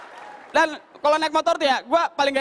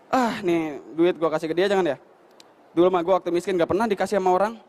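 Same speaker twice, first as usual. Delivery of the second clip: stingy rich man; 7 semitones lower than usual. Retold or imitated. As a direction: mull over not giving money. Rich man's stingy inner voice.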